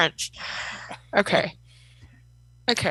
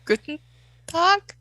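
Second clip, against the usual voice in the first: unsure German; 8 semitones higher, 3 dB quieter than usual. Acted. Player attempts German goodnight.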